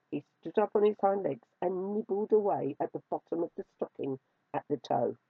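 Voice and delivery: ordinary delivery, nothing marked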